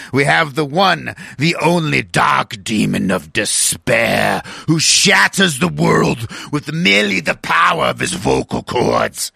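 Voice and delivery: slow, drawn-out wrestling-style introduction; hype announcer intro. Acted. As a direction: build the guest up like a ring announcer.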